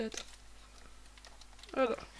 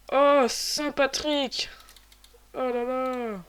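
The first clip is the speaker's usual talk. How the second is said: fort, très lent et haut; émerveillé; 2 semitones higher, 10 dB louder, much slower than usual. Real, not acted. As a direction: savoure la trouvaille. Trouve du bon matériel. (émerveillement appuyé)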